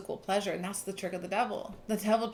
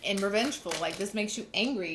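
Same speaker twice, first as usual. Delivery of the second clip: usual speaking voice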